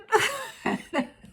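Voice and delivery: squealing laugh way up high. Real, not acted. Laughing at her own complaint to Billy, high laugh. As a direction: burst into a high giggle.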